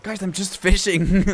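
loud, high, drawn-out protest; exasperated protest. Real, not acted. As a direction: protest loudly to teasing friends. Protesting friends' teasing about panic.

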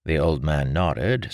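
ordinary delivery

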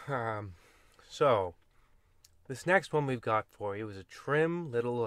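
normal talking voice

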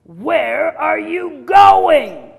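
very loud, very slow wolf roar; booming wolf. Acted. Wolf looming over the caught girl, roaring. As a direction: stretch and roar each word.

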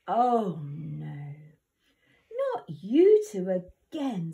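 loud, weary, scolding godmother; fed up with troublemakers again; exasperated fairy godmother. Acted.

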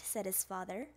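ordinary delivery

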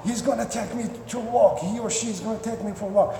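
high voice for a thrilled dog; acted giddy pet; excited dog's voice. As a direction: play the dog bursting for a walk. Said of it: "So your dog is gonna go".

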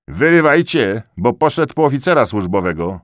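loud, high, fast warning; urgent shout. A narrator's reading. Tyrolean soldier urging friends to flee, shouting fast. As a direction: shout at them to run, quick.

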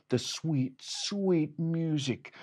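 drawn-out dreamy words; slow savoring. A narrator's reading. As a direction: savor each word slowly. Savoring the sweet music.

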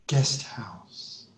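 ordinary talk

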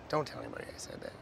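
ordinary talk

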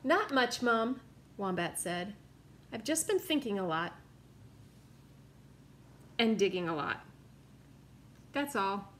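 lively picture-book read-aloud; story narration. A narrator's reading. Reading a picture book to children, lively. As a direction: read the story to little listeners.

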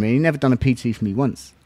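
usual speaking voice

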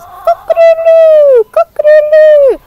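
crowed high and loud like a rooster; a big, high, loud rooster call for the nursery children. Acted. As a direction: belt it out like a proud rooster at dawn. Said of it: "And he goes".

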